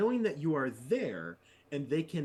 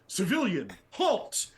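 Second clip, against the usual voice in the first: loud, much higher barked order to stop; commanding shout; 6 semitones higher, 5 dB louder than usual. Pretend. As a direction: bellow at a fleeing teen to halt. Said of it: "You go".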